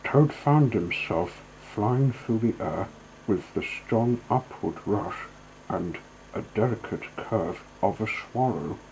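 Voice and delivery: usual speaking voice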